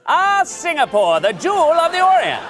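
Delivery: put-on high, loud old-timey newsreel narrator; hammy vintage newsreel voice; old radio announcer. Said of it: "Why not just make an old-timey newsreel?".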